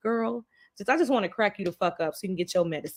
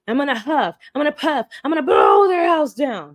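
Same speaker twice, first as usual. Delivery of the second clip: building to a full shout, higher and louder; angry friend yelling; 5 semitones higher, 7 dB louder than usual. Retold or imitated. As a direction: huff and puff like a furious friend. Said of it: "They were really fucking upset".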